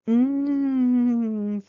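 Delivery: high, loud hum of pleasure; delighted mmm. Acted. Girl tasting the lima beans, delighted hum. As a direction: savour the taste aloud.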